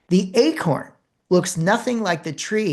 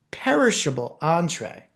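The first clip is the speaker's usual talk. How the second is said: ordinary delivery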